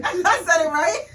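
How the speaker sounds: high and loud through laughter; laughing. Real, not acted. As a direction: insist while cracking up. Laughing while insisting.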